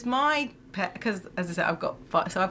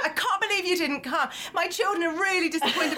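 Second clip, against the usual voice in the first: mock angry phone call; 6 semitones higher than usual. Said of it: "he's phoned the tooth fairy and was going".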